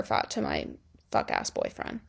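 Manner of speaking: breathy whispered jab, dropped low; whispered aside. Real, not acted. Confiding to viewers about the boyfriend, whispered. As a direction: slip it in under the breath.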